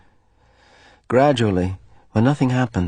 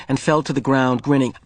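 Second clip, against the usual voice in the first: ordinary delivery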